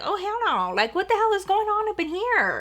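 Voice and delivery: high-pitched mock outrage; vocal, appalled mom. Mom looking around the messy house, high appalled exclamation. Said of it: "my mom is very vocal".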